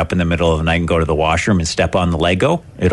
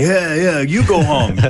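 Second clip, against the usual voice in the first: mock heckling; 7 semitones higher than usual. Imagined player heckles the umpire.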